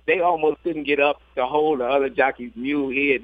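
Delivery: ordinary delivery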